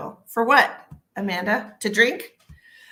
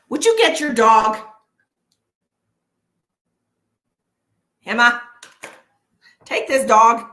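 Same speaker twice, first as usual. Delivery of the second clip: loud, raised call across the house; exasperated calling out; 3 semitones higher, 10 dB louder than usual. Real, not acted. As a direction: yell for someone to come and help. Calling someone to take dog away.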